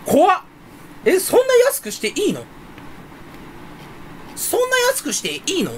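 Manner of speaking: high-pitched astonished questioning; hammed-up disbelief. Real, not acted. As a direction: squeal in disbelief at a bargain. 値下がりに大げさに驚く. (甲高い驚きの声)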